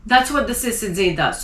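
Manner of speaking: ordinary talk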